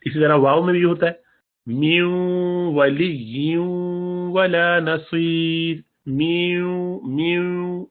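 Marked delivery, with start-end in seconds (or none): 1.6-7.9 میو ولی with the nun hummed through the nose into the waw; slow chanted ولا نصیر, nasal merge; nasal ghunna recitation. Shown as a demonstration. Teacher chanting Quran example: nasal merge into waw. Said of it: "نون کی آواز نکالیں گے ناک سے".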